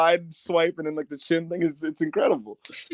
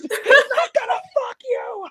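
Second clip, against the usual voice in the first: high, slow yelled retort, laughing through it; high stretched mock shout; 3 dB louder, much slower than usual. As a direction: yell back at her in a squeaky laughing shout. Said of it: "And I was like".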